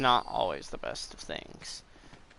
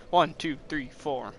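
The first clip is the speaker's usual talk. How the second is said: ordinary delivery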